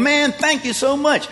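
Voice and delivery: high, eager thank-you; gushing gratitude to the steward; grateful thanks. Retold or imitated. Debtor gushing thanks to the steward, high and eager.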